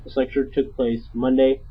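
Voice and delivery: ordinary talk, nothing marked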